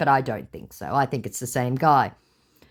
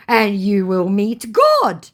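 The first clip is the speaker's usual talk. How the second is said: slow, higher, louder put-on threat; mock threat; 5 semitones higher, 6 dB louder, slower than usual. Scammer threatening her with meeting God, mock ominous. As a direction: pronounce doom, hammed-up. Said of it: "he threatens".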